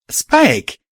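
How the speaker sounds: high, loud gasped cry; a sharp alarmed call. Acted. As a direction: blurt the name in sudden fear. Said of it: "I gasped".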